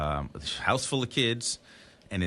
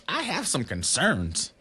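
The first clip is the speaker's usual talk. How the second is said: high, slow prim caseworker; 9 semitones higher, slower than usual. Retold or imitated. Dad imitating the home study lady, slow and high. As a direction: draw it out like a fussy inspector. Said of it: "she's like".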